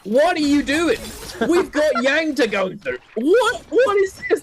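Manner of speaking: high, loud mock outrage at the players; incredulous shout. Caster voices teams' outrage.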